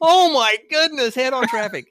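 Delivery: high, loud cry of panic; mock alarm. Driver suddenly facing head-on traffic, crying out in mock panic.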